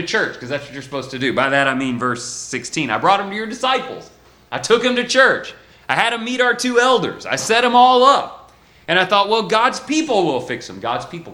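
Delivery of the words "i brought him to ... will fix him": higher, louder pleading father's voice; desperate father. Retold or imitated. Pleading father telling the preacher he tried church. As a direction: voice the frantic dad who tried everything.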